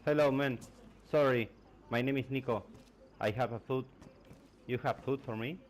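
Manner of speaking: usual speaking voice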